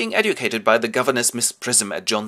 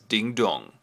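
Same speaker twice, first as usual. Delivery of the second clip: usual speaking voice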